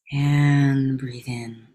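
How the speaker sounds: very slow, drawn-out cue to inhale; unhurried coach cue; slow breathing cue. Acted. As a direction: stretch the words out like a long inhale.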